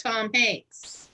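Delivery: ordinary delivery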